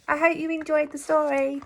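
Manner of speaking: plain reading voice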